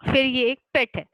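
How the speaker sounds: ordinary talk, nothing marked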